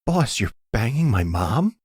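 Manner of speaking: stunned son catching his boss with his mom; shocked disbelief. Acted. Danny finds boss with mom.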